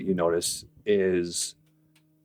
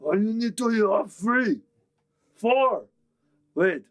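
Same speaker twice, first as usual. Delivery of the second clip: orc voice; 14 semitones higher than usual. Acted. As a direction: argue like a simple brute. Orc haggling over elves.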